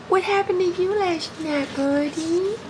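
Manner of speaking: high, sweet cooing at the dogs. Real, not acted. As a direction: coo at the puppies like babies. Cooing at the puppies.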